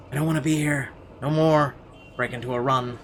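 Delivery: ordinary talk, nothing marked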